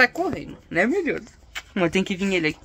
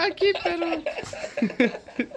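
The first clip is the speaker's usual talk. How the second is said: much higher cooing to the dog; pet talk; 13 semitones higher than usual. Real, not acted. Owner coaxing Pérola the dog in cooing pet talk.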